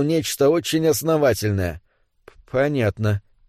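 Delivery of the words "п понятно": lower, stumbling on the first sound; hesitant. Acted. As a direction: agree uncertainly, with a small stutter. David nods, not really understanding. (неуверенно, с запинкой)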